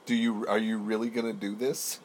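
loud, mock-outraged friends; mock exasperation. Imitating annoyed friends at the table, mock exasperation. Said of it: "They're gonna be like".

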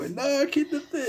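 high, slow, teary whine; imitating crying. Boy crying at his dad after the scare, high teary whine. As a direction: whimper it like a scared kid. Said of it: "Y yo llorando así como".

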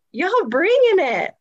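high, stretched-out BRINGING; emphatic hype. Real, not acted. Praising Florida powerhouses, emphatic and hyped. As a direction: draw out BRINGING with rising excitement. Repeats praise, drawn out and higher.

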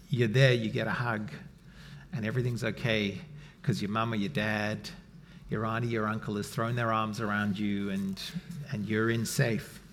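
put-on soft and low, like soothing a hurt child; tender hush. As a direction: drop to a gentle near-hush. Tender image of parent's hug.

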